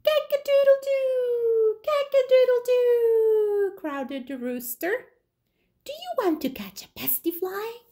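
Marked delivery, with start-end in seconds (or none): 0.0-3.8 high, loud crowing cry; rooster crow. Acted. Rooster crows. 5.8-7.8 high, fast rooster voice; quick high rooster. Acted. Rooster asks the spider.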